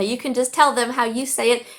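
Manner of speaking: ordinary delivery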